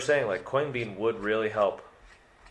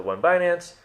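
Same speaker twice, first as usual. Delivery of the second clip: high, eager mock demand; a hint of fans pestering, rising; impatient question; 8 semitones higher, 5 dB louder than usual. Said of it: "when you guys say the".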